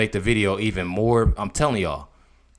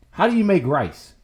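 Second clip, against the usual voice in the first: normal talking voice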